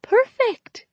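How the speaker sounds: squealed very high with joy; delighted. A narrator's reading. Becky clapping in delight at karaoke news, squealing high. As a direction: shriek it with glee. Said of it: "clapped in delight".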